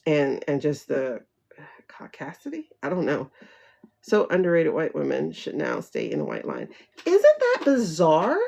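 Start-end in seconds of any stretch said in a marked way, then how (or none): 1.9-2.6 whispered punchline. Real, not acted. To viewers, a sly whispered joke word. 7.0-8.4 slow, high disbelief; incredulous. Real, not acted. Reacting to a comment, slow amazed disbelief.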